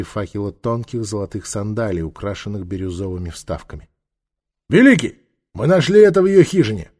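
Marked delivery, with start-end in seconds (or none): 4.7-6.9 high, loud soldier's report; urgent report. Acted. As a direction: call out a discovery to the ruler. Soldier reports find to ruler.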